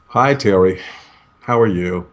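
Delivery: low energy. Shown as a demonstration. Negotiator demos a flat greeting on a video chat, low energy. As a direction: greet with no enthusiasm at all. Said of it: "you can see there's not a whole lot of energy".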